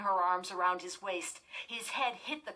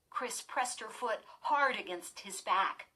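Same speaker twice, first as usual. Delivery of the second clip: ordinary delivery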